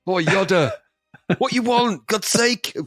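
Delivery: high, exasperated cockney Yoda; cocky East End Yoda. Acted. Yoda interrupted at his chores, cocky East End grumble. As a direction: grumble high like a put-upon East Ender. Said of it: "What accent is that?".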